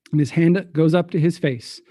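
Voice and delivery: ordinary delivery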